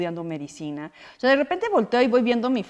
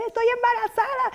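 much higher, thrilled; excited announcement. Retold or imitated. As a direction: squeal the news. Daughter's announcement retold.

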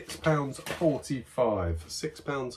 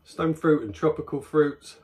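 talking while sniffing beer; muffled behind glass rim; speaking into a glass; 5 semitones higher, 4 dB louder than usual. Real, not acted. Seen 1: glass held against nose and mouth throughout, sniffing.

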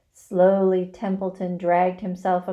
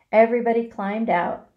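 plain reading voice

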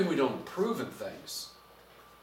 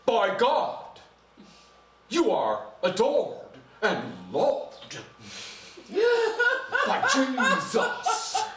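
hammed-up pulpit voice, each phrase drawn out; mock preacher, slow with big swoops and long pauses; put-on preacher voice; 8 semitones higher, much slower than usual. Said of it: "their voice changed".

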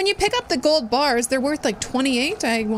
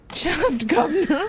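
slow put-on British accent, drawn out; copying a Cockney phrase in a slow British accent; mock British accent; 3 semitones higher, slower than usual. Shown as a demonstration. Gamer copies the character's British accent, slowly. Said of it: "I'm stealing that accent too".

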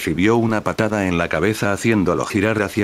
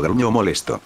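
ordinary delivery

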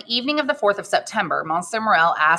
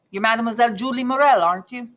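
put-on higher-pitched, lightly done Italian accent; Italian-accented question, raised in pitch; 6 semitones higher than usual. A narrator's reading. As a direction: play a stranger with an Italian accent. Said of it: "he said with a pronounced Italian accent".

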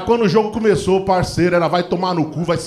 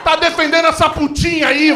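indignant outburst; 7 semitones higher, slower than usual. Retold or imitated.

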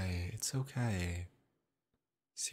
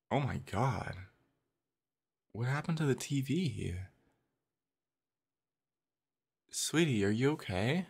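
alarmed; 5 semitones higher, 4 dB louder than usual. Acted. Finds TV broken, partner upset.

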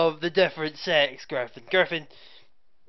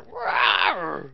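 dinosaur voice; 5 semitones lower than usual. Retold or imitated. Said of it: "Tyrannosaurus Rex there just going".

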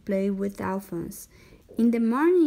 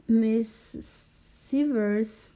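plain reading voice

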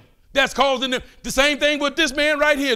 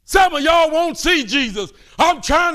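fervent preaching; 6 semitones higher, 5 dB louder than usual. Real, not acted. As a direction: drag it out high and loud. Preaching climax.

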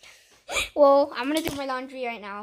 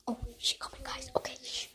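whispering; 9 dB quieter than usual. Real, not acted. Sister approaching.

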